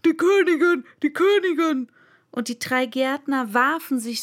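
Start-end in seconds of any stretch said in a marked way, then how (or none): 0.0-1.9 high, loud, alarmed calling; frightened cry. Acted. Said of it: "rief Fünf, der ängstlich".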